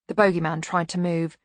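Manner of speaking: ordinary delivery, nothing marked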